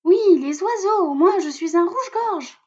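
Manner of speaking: a small robin insisting; insistent robin voice. A narrator's reading. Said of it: "insiste Musica".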